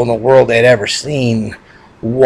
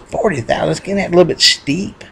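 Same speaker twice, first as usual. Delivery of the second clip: higher, taken-aback buyer; surprised haggling; 8 semitones higher, faster than usual. Acted. Farmer to seller over forty thousand, surprised. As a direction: balk at the price in a raised pitch.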